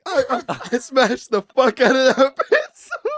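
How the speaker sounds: high, loud, cracking up while talking; helpless laughing storytelling; real laughter. Real, not acted. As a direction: barely get it out through laughter. Cracks up telling his story.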